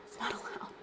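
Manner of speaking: breathy whisper of the same line; whispered aside. Shown as a demonstration. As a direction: drop it to a whisper, half to herself. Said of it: "repeats line, then whispers it".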